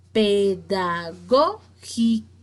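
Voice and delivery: Pedagógico slowly in separate syllables, "gó" stressed; teacher sounding out Pedagógico to show the strong "gó". Shown as a demonstration. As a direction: break Pedagógico up, punching the "gó". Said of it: "la que se pronuncia con mayor".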